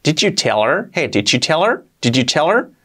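"Did you tell her" as "dichu teler"; fuse "Did you" with a ch, no h in "her"; ch-linked. Shown as a demonstration. Teacher modelling native merging, ch-linked question. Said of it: "se hace chichu".

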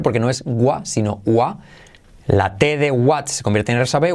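ordinary talk, nothing marked

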